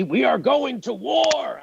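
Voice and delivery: high, drawn-out mock war cry; angry Cenk Uygur. Podcaster mocking an angry rival host, mock shout. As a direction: bellow it slow and high, mocking his anger. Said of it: "his badge of honor was that he was angry".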